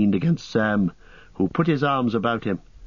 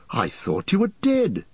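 mild cry; 8 semitones higher than usual. Acted. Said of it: "he cried".

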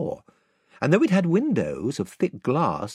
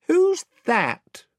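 much higher, peevish and cross; irritable; 5 dB louder than usual. Acted. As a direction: snap it like a seasick, grumpy boy. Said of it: "said Eustace irritably".

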